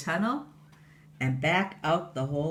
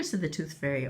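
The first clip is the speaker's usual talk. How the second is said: ordinary delivery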